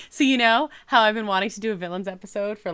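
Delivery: normal talking voice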